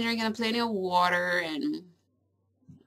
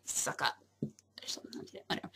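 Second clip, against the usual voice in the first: whisper; 7 semitones lower, 12 dB quieter, much faster than usual. Real, not acted. Unsure trailing aside.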